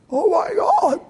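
high, tearful mock cry; loud, strained high wail of disbelief; imitated choking up. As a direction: act out someone overcome with emotion. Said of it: "they're standing there choking up".

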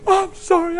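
high, slow wail; imitating a bawling wife; imitated tearful apology. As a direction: stretch it out in a high mock cry. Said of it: "I'm bought".

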